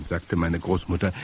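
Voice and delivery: usual speaking voice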